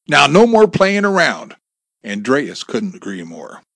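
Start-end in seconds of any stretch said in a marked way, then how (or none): 0.1-1.6 loud, high-pitched command; yelled warning. A narrator's reading. Gunman to his hostages after shooting, yelled warning. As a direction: yell it like a final warning. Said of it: "Bob yelled".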